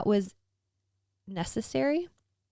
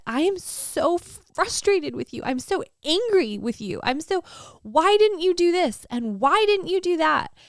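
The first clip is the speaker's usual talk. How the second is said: higher, louder, building to a cry of why; angry at God; 9 semitones higher, 5 dB louder than usual. Retold or imitated. Shouting her anger at God in prayer, higher and louder. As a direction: pour it out, louder with each why. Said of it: "I was just totally honest".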